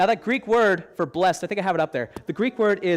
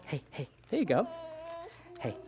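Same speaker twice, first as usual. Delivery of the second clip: ordinary talk, nothing marked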